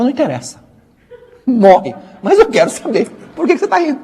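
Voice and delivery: high-pitched mock insistence; hammed-up demand, voice shooting up; acted stubborn wife. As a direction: play a wife who will not back down. Wife refuses to back down.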